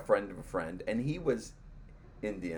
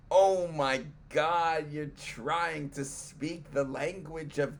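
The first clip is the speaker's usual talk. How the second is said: gushing praise; 6 semitones higher, slower than usual. Retold or imitated. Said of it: "I don't, like".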